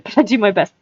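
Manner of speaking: plain reading voice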